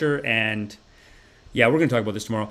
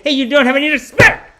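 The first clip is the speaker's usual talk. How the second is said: higher, much louder mock scolding; mom scolding; 14 semitones higher, 10 dB louder than usual. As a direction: shout at the disrespectful son. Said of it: "They say".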